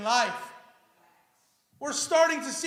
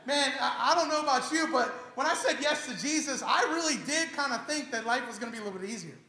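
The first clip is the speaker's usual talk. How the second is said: ordinary talk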